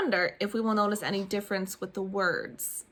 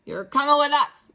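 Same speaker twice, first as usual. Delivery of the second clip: pirate voice; 5 semitones higher, 7 dB louder than usual. Acted. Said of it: "Braid Beard told me".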